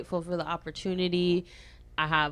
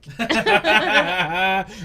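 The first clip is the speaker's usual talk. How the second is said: loud laugh; 7 dB louder than usual. Real, not acted. Big laugh after story.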